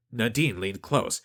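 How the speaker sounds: ordinary delivery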